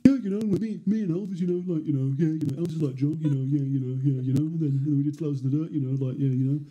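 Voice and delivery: run-on mock Scouse mumble. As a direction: burble along in halting, lilting Liverpudlian. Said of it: "that's how he talks".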